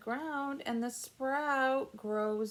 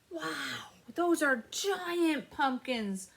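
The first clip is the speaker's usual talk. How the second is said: higher, slow wonder; amazed; 6 semitones higher, 3 dB louder, slower than usual. Acted. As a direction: show the kids how amazing it is. Amazed at picture.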